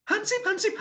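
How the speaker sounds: high falsetto, teasing and pleased; enjoying it. Retold or imitated. Joke about the girl, high mock pleased voice. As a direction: call him as if enjoying it now. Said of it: "lama-lama mungkin menikmati ya".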